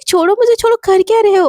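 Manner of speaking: high, protesting cry to let go. Acted. Shivani protests as Ananya drags her off, high angry. Dragged away by her friend.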